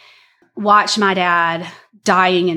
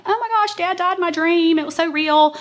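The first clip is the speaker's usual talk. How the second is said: much higher, mock tearful panic; retold upset crying; 9 semitones higher than usual. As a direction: replay the child's frightened wail. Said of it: "and I'd be crying".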